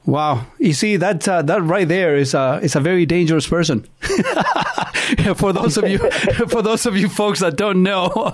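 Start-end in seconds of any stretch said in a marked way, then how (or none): 3.9-8.3 laughing. Real, not acted. Host jokes guest is dangerous.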